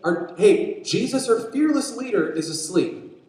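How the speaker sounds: high, urgent exclamation; alarmed disciple. Acted. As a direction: call out the discovery to the crew. Panicked disciple spots Jesus asleep.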